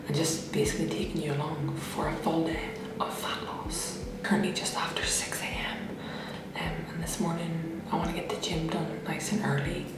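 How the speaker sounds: whispering. Real, not acted. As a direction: keep it hushed, people asleep. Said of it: "I'm having to whisper this morning".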